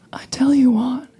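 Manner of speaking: imitating his lean-in whisper; mock close whisper. Barmaid turning his proposition back on him, whispered. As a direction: whisper it right in his ear. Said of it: "and now come in real close like".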